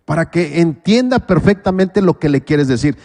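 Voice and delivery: usual speaking voice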